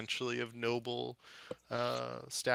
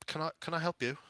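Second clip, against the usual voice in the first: halfling gardener; 8 semitones higher, faster than usual. Acted. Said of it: "kinda looks over and goes".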